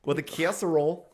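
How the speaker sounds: mock Midwestern mom, pushed out; playful Midwestern accent impression. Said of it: "a Midwestern mom".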